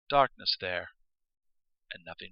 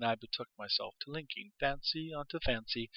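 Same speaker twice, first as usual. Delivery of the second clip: ordinary delivery, nothing marked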